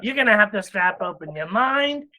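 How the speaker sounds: eerie high-pitched alien character, very slow; alien torturer voice. Acted. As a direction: stretch every word like a relishing alien torture expert. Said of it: "he's like this".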